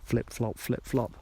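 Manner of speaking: soft, drawn-out Flip flop with heavy pauses; dramatic emphasis. Shown as a demonstration. Podcaster giving listeners a dramatic pause, softly. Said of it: "That was dramatic emphasis".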